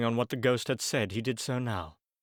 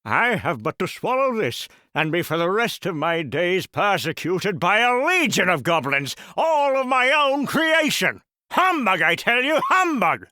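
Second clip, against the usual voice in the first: rising into a loud, high shout on humbug; frantic outburst; 6 semitones higher, 6 dB louder than usual. Acted. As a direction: scoff and yell it down in panic. Scrooge's frantic outburst at ghost.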